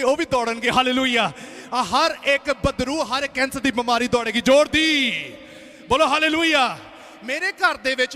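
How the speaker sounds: loud, driving sermon building to shouted calls; full-force preaching. Real, not acted. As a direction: preach with mounting force, then belt out the call. (ਪੂਰੇ ਜ਼ੋਰ ਨਾਲ ਪ੍ਰਚਾਰ)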